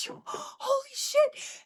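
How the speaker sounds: very high, slow, cracking exclamation; shocked squeak of disbelief; stunned disbelief. Retold or imitated. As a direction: gasp it out in shock. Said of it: "And I was like".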